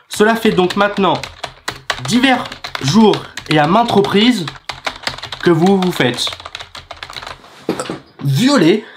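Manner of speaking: mock official blurting each phrase out while typing; reading back aloud. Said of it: "il redit à voix haute avec son clavier".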